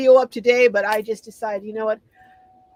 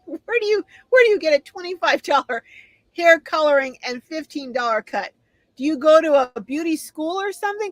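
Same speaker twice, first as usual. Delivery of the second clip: teasing disbelief; 6 semitones higher than usual. Real, not acted. Host mocks cheap hair price.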